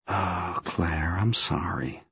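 hushed, soft and sorry; soft murmured comfort; tender apology. A narrator's reading. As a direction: apologise very gently, almost whispering. Consoling his crying lover.

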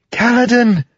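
high, loud cry of his name; urgent call. A narrator's reading. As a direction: call out sharply to a fallen friend. Syl cries out to fallen Kaladin.

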